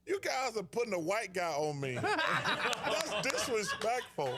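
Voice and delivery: laughs while quoting Larry; can barely get the quote out for laughing; cracking up mid-story; laughing through it. Real, not acted. Retelling Larry's line, laughing.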